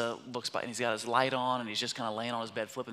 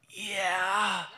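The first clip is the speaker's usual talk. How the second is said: high, drawn-out sleepy yeah; groggy son; 7 semitones higher than usual. Retold or imitated. Son woken at five agrees, groggy. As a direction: imitate a kid fighting out of sleep. Son half asleep answering.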